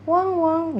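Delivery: high, playful woof-woof; dog bark imitation. Shown as a demonstration. Teacher imitates a dog for students, playful high bark. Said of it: "Suaranya gimana?".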